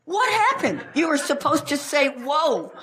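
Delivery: high-pitched mock outrage; exasperated demand. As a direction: squawk it at the kids in disbelief. Acted scolding of kids after crash.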